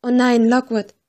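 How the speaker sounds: plain reading voice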